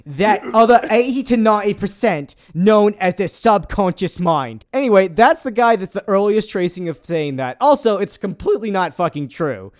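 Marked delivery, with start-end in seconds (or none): 0.1-4.7 loud mock Cockney Michael Caine quote; hammed-up Cockney Michael Caine, louder. Quoting a corporate mentalist in a Cockney Michael Caine voice. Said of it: "Michael Caine it up".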